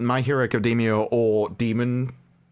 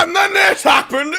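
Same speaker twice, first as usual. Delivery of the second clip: much higher, louder hammed-up outburst; mock Az rant; 7 dB louder than usual. Co-host mimicking Az's rants, much higher mock yell. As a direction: blurt it out like a panicking ranter. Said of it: "Az is like".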